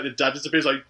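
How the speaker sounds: ordinary talk, nothing marked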